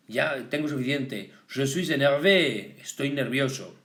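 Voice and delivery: usual speaking voice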